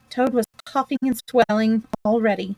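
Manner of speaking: ordinary delivery, nothing marked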